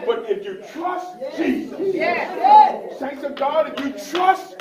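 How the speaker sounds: loud, rising preacher's cadence with hallelujahs; full-force sermon delivery; fervent preaching. Acted. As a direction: preach it out to the congregation.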